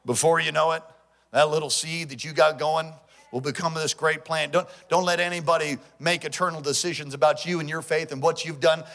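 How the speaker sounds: ordinary delivery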